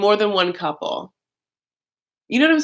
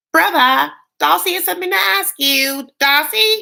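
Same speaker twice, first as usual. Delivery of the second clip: much higher, louder sister call; sister announcing; 9 semitones higher, 4 dB louder than usual. Retold or imitated. Sister calling brother over, high loud announcing voice. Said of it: "the sister's like".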